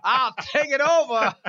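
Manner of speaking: joking while laughing; laughing tease. Real, not acted. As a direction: laugh it out playfully.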